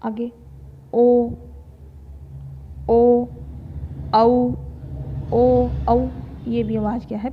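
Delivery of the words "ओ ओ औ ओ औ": ओ and औ called out much louder; teacher belting back vowels; loud rounded vowel demo. Shown as a demonstration. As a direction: round the lips and belt ओ, औ. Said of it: "पीछे से आ रही है".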